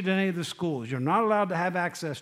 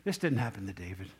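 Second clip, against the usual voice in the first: ordinary talk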